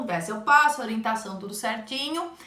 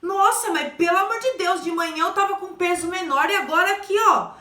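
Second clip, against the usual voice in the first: higher, faster, dismayed outburst; higher-pitched mock alarm at the scale; dismayed patient; 6 semitones higher than usual. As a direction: play the patient shocked at the scale. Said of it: "aí ela fala assim".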